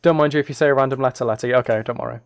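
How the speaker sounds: ordinary talk, nothing marked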